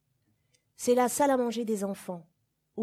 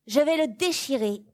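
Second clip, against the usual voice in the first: higher and louder, falling on déchirer; loud defiant vow; 5 semitones higher, 5 dB louder than usual. Acted. Child warning the governess, loud defiant vow. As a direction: announce it like a warning. Child announcing the forbidden act.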